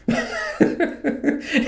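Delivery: high, quiet giggle; real high chuckle of frustration; exasperated laugh. Real, not acted. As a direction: laugh helplessly mid-complaint. Laughs before admitting anger.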